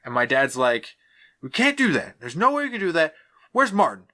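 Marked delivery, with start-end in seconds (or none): 1.4-4.0 raised coach voice calling for Martin; mock-yell the dad hunting for his player. Dad yelling across the room for Martin.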